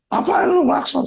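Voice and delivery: high, raging retort from the driver; mock furious yell. Angry father on the motorbike yelling over the horn, high. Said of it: "Dia ngamuk".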